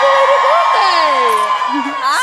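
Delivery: slow yelled greeting. Retold or imitated. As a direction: call it out across the room. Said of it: "Somebody yelled happy belated birthday".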